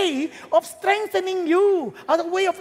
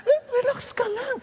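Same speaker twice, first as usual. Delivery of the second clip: usual speaking voice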